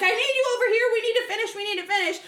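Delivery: high, hurried and pressing; urgent makeup artist. Retold or imitated. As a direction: rush her along. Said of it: "the makeup artist is like".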